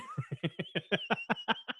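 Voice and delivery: high staccato giggle; real laughter. Real, not acted. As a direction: crack up in a long, quick run of ha-has. Cracking up at the retold exchange.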